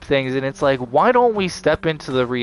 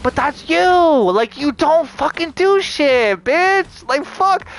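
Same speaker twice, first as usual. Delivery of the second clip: a shrill outraged yell, slowed and hammered out; furious shout; 10 semitones higher, slower than usual. Real, not acted. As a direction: scream it right in their face. Ranting back at online critics.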